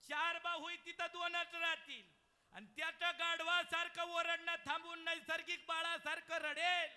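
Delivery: loud, high sermon cry; full-force preaching. Acted. Kirtankar telling the birth story, loud preaching. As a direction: preach it out to the back row. Heavenly voice told loudly.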